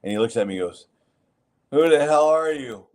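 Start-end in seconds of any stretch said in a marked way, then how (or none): 1.7-2.8 higher, louder gruff coach yelling; retold angry yell. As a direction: bark it like a surprised, angry coach. Coach confronts him in gym.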